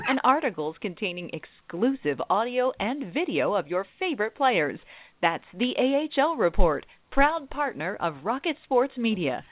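upbeat sponsor ad read. Acted. Host reading the sponsor's promo, upbeat ad read. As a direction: sell it like a radio spot.

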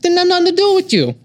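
Boston Joe Pesci. Acted. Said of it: "I'm gonna be a Boston Joe Pesci".

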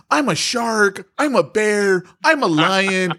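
put-on high, proud kid bragging; hammed-up childish boast; boastful kid voice. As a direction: chant it like a proud kid naming animals. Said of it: "I could not stop laughing at".

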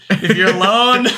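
high-pitched talk through laughter; helpless laughing delivery. Real, not acted. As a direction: try to get it out while cracking up. Cracking up at own joke.

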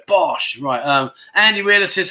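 usual speaking voice

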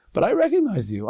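high-pitched, insistent recognition; excited recognition. Retold or imitated. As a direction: insist eagerly, pitch leaping well up. Peasant assures king he knows him.

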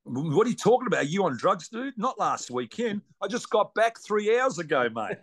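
mock disbelief, pitched high; playfully outraged, what are you on; incredulous. Real, not acted. Mock outrage at wrong date.

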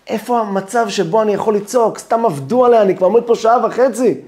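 frustrated. Acted. Frustrated waiting zealot.

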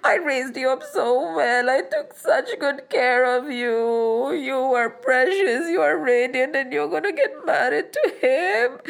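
whimpering mother, acted crying; tearful lament. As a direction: wail reproachfully through mock tears. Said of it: "the mother started crying".

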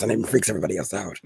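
very low, fast sultry voice; sultry narrator. Shown as a demonstration. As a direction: drop into a deep, sultry purr. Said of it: "And then I go like this".

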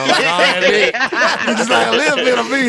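high, loud, laughing through the taunt; mock him while cracking up; laughing tease. Real, not acted. To his friend who dislikes meat, laughing mockery.

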